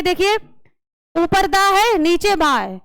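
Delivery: usual speaking voice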